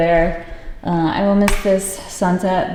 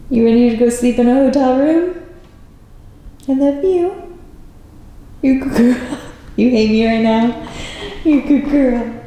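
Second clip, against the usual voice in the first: talking to the dog; 3 semitones higher than usual. Real, not acted. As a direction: fuss over the dog lovingly.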